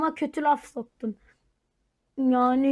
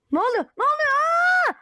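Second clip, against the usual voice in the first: startled; 12 semitones higher, 3 dB louder, faster than usual. Acted. Startled by neighbor.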